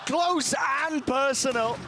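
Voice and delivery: high, slow excited commentary; esports caster. Real, not acted. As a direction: stretch each word with hype. Caster calls the aftermath.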